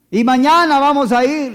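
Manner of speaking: bright, loud promising father; empty promise. Retold or imitated. Father cheerfully promising his child a dinner out. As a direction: promise it big and cheerful, knowing it won't happen.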